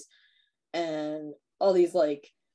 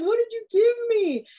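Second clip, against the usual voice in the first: much higher, louder, frantic; mock panic; 7 dB louder than usual. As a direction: squeal it in mock terror. Panicking guy in story.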